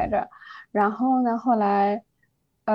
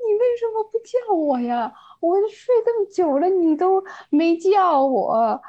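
retold weepy complaint, pitched much higher, slowing at the end; wronged whiny plea; 8 semitones higher, 3 dB louder than usual. A student reproaching her friend for not waking her, whining tearfully. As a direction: whine it like a wronged kid about to bawl. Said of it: "我就当着沈思雨的面儿哇哇大哭".

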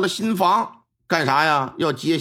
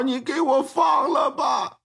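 pleading wail; 9 semitones higher, slower than usual. Acted. Beaten prisoner begs the magistrate.

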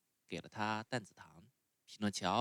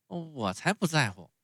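put-on higher, louder stubborn child; defiant puppet boy; 7 semitones higher, 8 dB louder than usual. A narrator's reading. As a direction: snap back defiantly. Pinocchio defiant.

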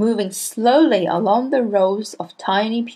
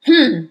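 louder, higher scornful snort; 4 semitones higher, 7 dB louder than usual. A narrator's reading. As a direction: snort in disdain at the girl. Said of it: "she snorted".

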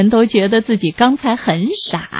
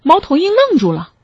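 plain reading voice

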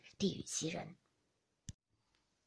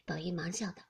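plain reading voice

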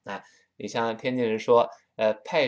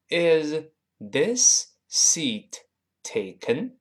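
slowed-down drill; 5 semitones higher, much slower than usual. Shown as a demonstration. Teacher drills the phrase slowly for learners, very slow. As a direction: break it down word by word, slowly. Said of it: "我们应该这么说".

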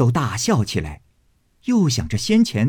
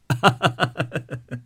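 narrator's chuckle; 3 semitones higher than usual. A narrator's reading. 大家都笑起来.

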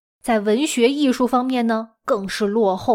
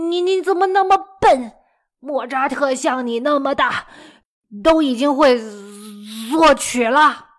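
furious scolding. Retold or imitated. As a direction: yell the comparison, exasperated. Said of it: "一边打骂贝多芬". (父亲拔高嗓门怒骂孩子)

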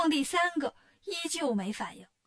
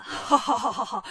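big acted ha-ha-ha; demon's laugh; 2 semitones lower, 4 dB louder than usual. A narrator's reading. Demon king amused by the hoarse challenger, laughing. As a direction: laugh out mockingly at the rival. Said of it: "赛太岁乐了".

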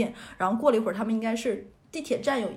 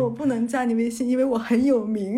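laughing; 7 dB louder than usual. Real, not acted. Retelling absurd refusal, laughing.

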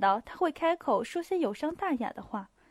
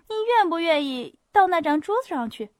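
louder, higher pressing invitation; insistent repeat; 5 semitones higher, 6 dB louder than usual. A narrator's reading. Hostess repeating her invitation to Pierre, insistent. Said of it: "把话重新说了一遍".

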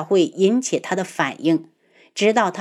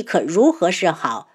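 ordinary delivery

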